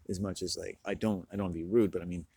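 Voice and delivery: ordinary delivery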